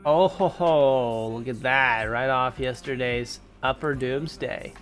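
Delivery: ordinary talk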